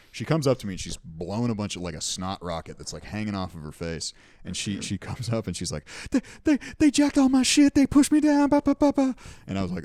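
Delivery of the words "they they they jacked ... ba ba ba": high, loud, hammed-up wail; mock wailing woman. Woman on the train begging with a staged wail. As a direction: fake a sob story to get money.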